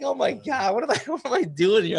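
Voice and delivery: high, loud, laughing self-doubt; mock panic. First-time author panicking over his draft, laughing mock panic. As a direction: squeal it through a laugh at yourself. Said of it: "I'm like".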